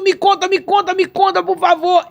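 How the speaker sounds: high, loud repeated pleading; insistent begging. Retold or imitated. Kneeling and begging Jesus to tell all, high and loud. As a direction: keep begging, high and urgent.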